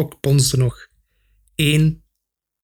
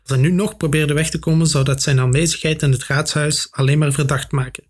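plain reading voice

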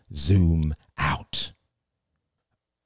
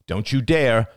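raised, stern warning to his daughter; father's sharp warning; 5 semitones higher, 5 dB louder than usual. A narrator's reading. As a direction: cut her off before the spoon moves. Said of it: "cried Ian".

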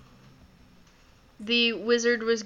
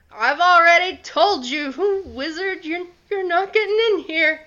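loud unsteady frightened voice; shaky voice; 7 semitones higher, 5 dB louder, faster than usual. Acted. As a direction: call out scared through the door. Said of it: "you hear a shaky voice from the other side".

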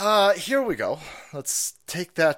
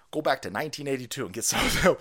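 ordinary talk

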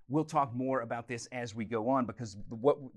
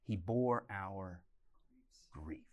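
a slow, much softer reading trailing into a whisper on "grief"; hushed scripture; 6 semitones lower, 6 dB quieter, much slower than usual. Acted. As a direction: breathe the verse out like a sorrowful confession.

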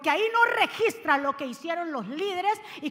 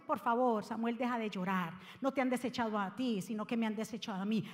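gentle voice of God; 5 semitones lower, 7 dB quieter than usual. Acted. God comforting weeping Samuel.

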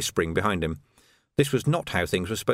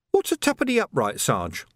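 put-on much higher, boyish recruit; an eager young constable; youthful lad's voice; young Sam; 7 semitones higher than usual. A narrator's reading.

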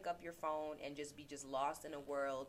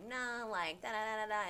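higher, dismissive mock voice; mocking ego voice; 6 semitones higher than usual. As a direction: brush it off like a nagging inner voice. Voicing her ego and pride.

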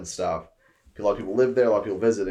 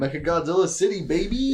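excited cheer; 6 semitones higher than usual. Real, not acted. Cheering the city idea.

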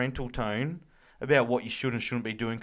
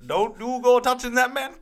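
[name] impression; 10 semitones higher, 6 dB louder than usual. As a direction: play a chilled Jamaican [name] voice. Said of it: "old [name]".

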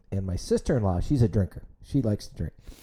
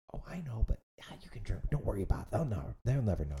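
whispering; 7 dB quieter, faster than usual. Retold or imitated. Sister-in-law sneaking bottles.

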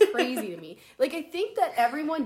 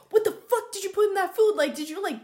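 louder, higher, accusing the roommate; mock angry yell; 6 semitones higher, 5 dB louder, faster than usual. Yelling at roommate over the food, mock angry. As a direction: chew her out over the food. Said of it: "ripping her out".

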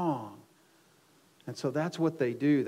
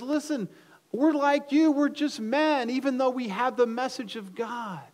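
high, raised, insistent appeal; urgent plea; 10 semitones higher, 5 dB louder than usual. Acted. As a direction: plead with the crowd to hear you out. Acting out Paul pleading with crowd.

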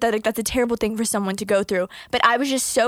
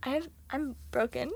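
hushed, halting admission; small, soft-spoken aside; quiet confession; 11 dB quieter than usual. Real, not acted. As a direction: drop to a much softer voice. Mock confession after trauma question.

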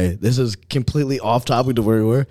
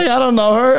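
higher, louder outraged aside; high, louder disbelief at her nerve; mock indignant; 13 semitones higher, 5 dB louder than usual. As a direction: act offended to your friend.

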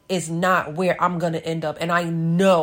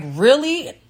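surprised really; 6 semitones higher, 4 dB louder than usual. Retold or imitated. Amazed at cousin's offer.

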